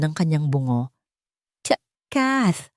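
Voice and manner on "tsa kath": garbled cry. A narrator's reading. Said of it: "isang garalgal na tunog".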